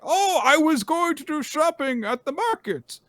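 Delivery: put-on very high, loud old-man voice; hammed-up doddery old man's realization; old man remembering. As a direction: exclaim it as a sudden recollection. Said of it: "He's like".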